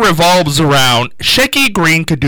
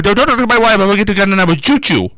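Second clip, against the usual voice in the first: put-on higher-pitched comic accented warning; Hervé Villechaize; 5 semitones higher, faster than usual. Jealous husband warning off a guest star, mock-accented. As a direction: imitate a riled little sidekick, pitched up. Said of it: "And then Hervé said".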